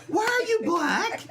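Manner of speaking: put-on high, loud, curious child question; little girl voice. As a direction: ask it like a puzzled small kid. Child's quote retold.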